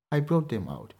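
ordinary talk

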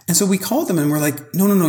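ordinary talk, nothing marked

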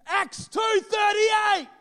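high, slow mock scream of Acts two thirty-eight. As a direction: scream it like the frightened old lady. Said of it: "she screamed".

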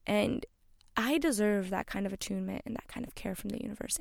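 ordinary talk, nothing marked

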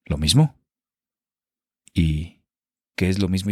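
milk every pause like a bad melodrama; solemn period-drama actor. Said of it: "todo tiene que ser muy solemne".

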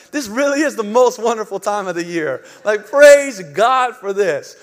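loud, hammed-up festive delight; mock rapture. Parent amid crying kids, mock rapture. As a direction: proclaim it loudly with exaggerated joy.

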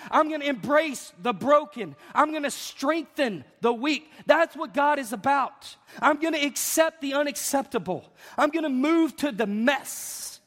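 high, driving pulpit cadence; fired-up preaching. Real, not acted. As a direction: build a rousing list, punching each key word. Preaching at full force.

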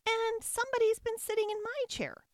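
tiny, very high squeak; shrill baby bear. A narrator's reading. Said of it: "squeaked the baby bear in his shrill little voice".

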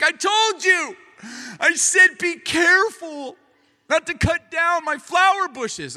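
high, loud mock shouting, drawn out; scolding wife. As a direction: imitate an exasperated wife yelling slowly. Retold wife scolds husband.

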